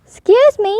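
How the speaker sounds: politely calling for attention. Acted. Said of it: "Portly said politely".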